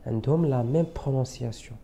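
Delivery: ordinary delivery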